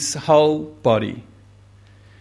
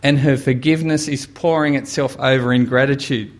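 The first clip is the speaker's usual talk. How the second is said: usual speaking voice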